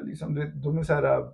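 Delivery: usual speaking voice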